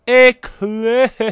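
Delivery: high, loud squeal on eclipsed; squealed emphasis. Real, not acted. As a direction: shriek the word eclipsed. Emphatic repeated word.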